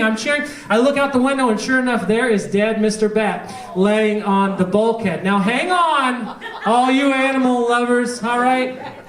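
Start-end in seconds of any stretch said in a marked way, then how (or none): none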